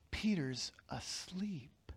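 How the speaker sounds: slow, breathy hush so as not to wake him; whisper. Acted. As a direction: whisper as if Peter sleeps nearby. Storyteller hushing about sleeping Peter.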